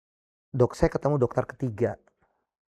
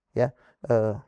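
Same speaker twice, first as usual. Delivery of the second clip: ordinary delivery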